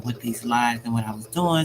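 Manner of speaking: normal talking voice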